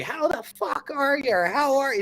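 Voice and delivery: high, drawn-out cheery hello, acted out; friendly, happy greeting. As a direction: greet strangers with over-friendly high pitch. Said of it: "like, friendly and happier than everybody".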